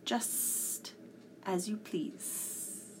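slow, soft cat voice; hushed, drawn-out S's, giving way; simply said. A narrator's reading. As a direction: yield quietly as a wounded cat. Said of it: "said simply".

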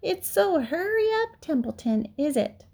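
high, mocking mimicry; rat's high-pitched taunting imitation; Wilbur. A narrator's reading. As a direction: mimic a whiny piglet, then drop to a sneer. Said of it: "began imitating Wilbur's voice".

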